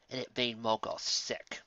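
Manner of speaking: ordinary delivery, nothing marked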